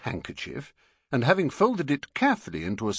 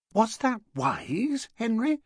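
Mrs Brown. Acted. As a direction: ask warily like an anxious wife. Mrs Brown voiced in story.

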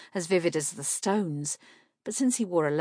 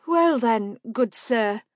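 nervous; 5 semitones higher, 6 dB louder, slower than usual. A narrator's reading. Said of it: "she found her voice with a little gulp".

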